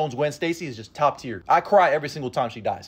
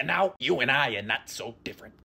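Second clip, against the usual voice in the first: Green Goblin; 3 semitones lower than usual. Acted. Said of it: "Willem Dafoe is the Green Goblin".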